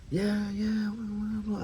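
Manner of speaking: high mock phone chatter; imitating a foreign guy's gibberish; foreigner on phone. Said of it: "on a phone with this guy's".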